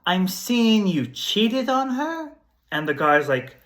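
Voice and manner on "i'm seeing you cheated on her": higher, slow, mock-mystical reveal; hammed-up psychic hotline reading; mystic psychic prediction. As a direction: lay it out like a TV psychic seeing the future. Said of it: "So basically she would predict".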